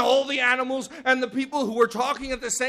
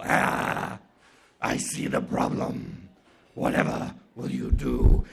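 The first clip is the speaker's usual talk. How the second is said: very low, breathy snake voice; deep, drawn-out rasp; great python; 12 semitones lower, slower than usual. Acted. Python considers Anansi's problem, deep and raspy.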